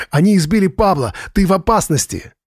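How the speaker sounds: высоко, в панике, задыхаясь; кричит в трубку, запыхавшись; задыхаясь крича. A narrator's reading. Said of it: "задыхаясь, кричал Джордж". (задыхающийся панический крик)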